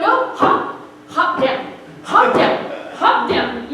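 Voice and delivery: loud, punchy skipping instructions; loud, bouncy re-enacted coaching; called-out coaching. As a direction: call out each hop like a drill, with punch. Coaching brother how to skip.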